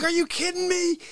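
yelling at masked drivers from the car; mocking yell. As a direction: yell at the idiot drivers, sneering. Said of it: "I'm yelling at people".

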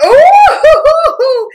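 very high, loud ooh breaking into laughter; delighted ooh. Real, not acted. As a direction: squeal ooh on seeing a gift. Delighted at next gift.

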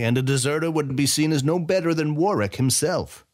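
a velvet threat from a patron; fatherly general. A narrator's reading. General warning Weyra never to desert, fatherly menace. Fatherly presence named line 110.

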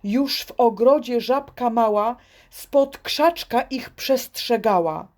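ordinary delivery

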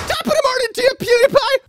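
very high, squeaky falsetto; hammed-up high-pitched hello; mock YouTuber greeting. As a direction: chirp it like a cartoonish catchphrase. Mimics YouTuber catchphrase.

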